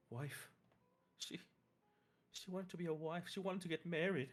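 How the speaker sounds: a hint of a shaken mother; grieving mother.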